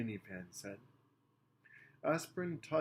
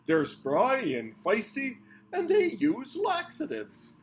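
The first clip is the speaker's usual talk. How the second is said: gushing alien; 13 semitones higher, 12 dB louder, slower than usual. A narrator's reading.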